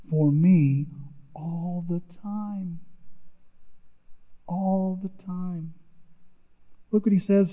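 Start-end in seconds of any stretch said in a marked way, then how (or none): 1.3-5.7 hushed repeat. Real, not acted. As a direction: drop to a near whisper on all the time. Repeats phrase softly for emphasis.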